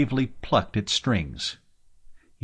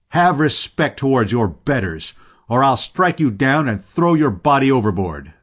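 loud, raised red-faced threat; roaring threat; 5 semitones higher, 6 dB louder than usual. A narrator's reading. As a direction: roar it as an angry warning. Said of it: "roared the sailor, red-faced".

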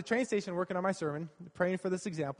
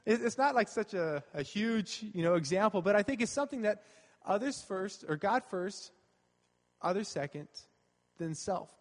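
ordinary talk

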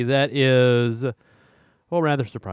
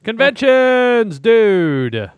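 much higher, louder, slow and drawn out; excited announcement; 10 semitones higher, 9 dB louder, much slower than usual. Real, not acted. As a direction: hype up the segment title. Announcing next segment.

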